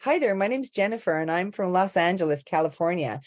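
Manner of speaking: re-enacted Californian introduction, American accent. Actor reciting accent spark back to coach, American accent. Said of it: "she was just like".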